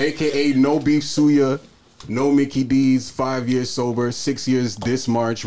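slow and loud, each title weighed; slow proud announcement. Pretend.

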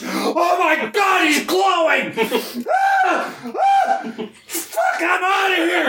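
high, terrified shrieks, then fleeing; panicked screaming. Acted. Principal panics at a glowing student.

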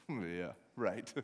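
low, quiet, dismissive brush-off; mocking scoff. Brothers laughing off David's boast, mocking. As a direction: scoff under your breath at a little brother.

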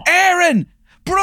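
high shouted name call; ring announcer. Retold or imitated. Announcer calling the name to the crowd, in a high shout. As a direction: belt out the name to the arena.

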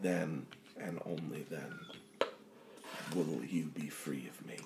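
low, hushed, deliberate menace; vengeful inner presence. Acted. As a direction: softly hold them captive with each phrase.

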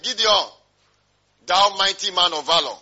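loud, ringing hail as an angel; acted loud angelic greeting; angel's proclamation. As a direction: proclaim it forcefully, like a heavenly salutation. Angel hailing Gideon in retold scene.

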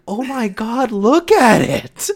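high, slow, awestruck mom voice; cutely oblivious mom. Retold or imitated. Son imitating his mom gushing at pizza, high and slow. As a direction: gush over it like a dazzled mom. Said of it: "She's just cutely oblivious".

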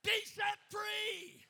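forceful revival declaration; shouted blessing. Real, not acted. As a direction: proclaim it over the crowd. Shouted blessing over congregation.

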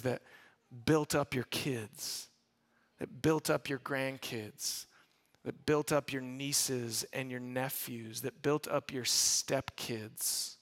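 low, slow and soft build-up; tender preaching climax; earnest, slowing. Real, not acted. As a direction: let each line land gently. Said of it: "preacher slowing for emphasis".

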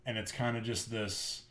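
normal talking voice

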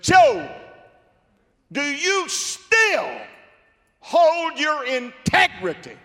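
high, loud scornful wife; mocking wife, high and slow; Job's wife. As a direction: needle him like a bitter wife. Job's wife taunts him.

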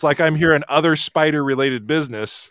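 ordinary delivery

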